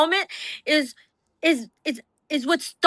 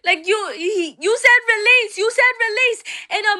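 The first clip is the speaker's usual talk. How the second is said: much higher, strained pleading yell; desperate yelling; 6 semitones higher than usual. Retold or imitated. Wife banging on the van window, much higher strained yell. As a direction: scream through the van window. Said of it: "I start banging on the window".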